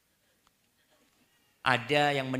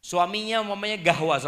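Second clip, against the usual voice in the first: normal talking voice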